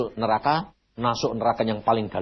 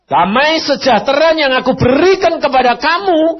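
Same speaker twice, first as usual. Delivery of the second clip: loud, high proclamation; proclaiming; 11 dB louder than usual. Acted. Preacher booms God's promise to the congregation.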